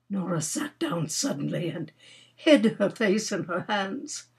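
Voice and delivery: ordinary delivery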